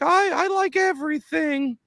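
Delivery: squeaky high mock voice of a bland local; mocking a Seattle local. Mocking Seattle people who have no opinions, in a squeaky high voice. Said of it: "everybody's just like".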